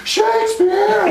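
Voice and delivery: slow, high, laughing cry of Shakespeare; excited shout. Retold or imitated. A college student answering classmates' party call, with a slow laughing shout. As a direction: cheer for Shakespeare like it's a party. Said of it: "And I was like".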